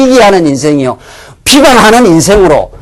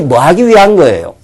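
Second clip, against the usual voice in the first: ordinary talk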